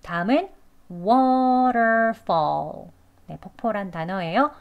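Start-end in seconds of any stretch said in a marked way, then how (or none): 0.9-2.9 "waterfall" hugely stretched, full r and L; careful learner pronunciation. Shown as a demonstration. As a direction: draw out both halves of "waterfall". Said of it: "배우는 발음으로".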